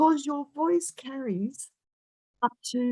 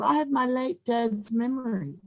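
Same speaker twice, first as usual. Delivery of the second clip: ordinary talk, nothing marked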